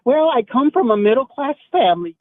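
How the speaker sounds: normal talking voice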